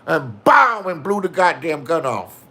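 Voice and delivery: ordinary talk, nothing marked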